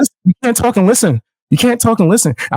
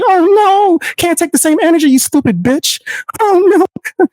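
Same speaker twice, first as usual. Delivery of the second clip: high-pitched taunting imitation of a whiner; mock a crybaby in a high voice; mock whining; 9 semitones higher than usual. Streamer imitates opponent who can't take it, mock whining.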